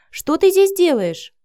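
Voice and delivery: put-on high, loud, drawn-out girlish question; surprised child. A narrator's reading. Cindy finding Vika in her home, surprised. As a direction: ask it in wide-eyed high-pitched surprise.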